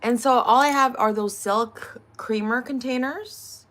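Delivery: usual speaking voice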